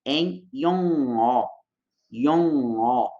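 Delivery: slow yong-o with the nasal ng flowing into the o; yong-o, back of throat, sound out the nose; nasal link demo. Shown as a demonstration. Teacher applying the nasal link to Korean, slow pron. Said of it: "Percebe como que dá?".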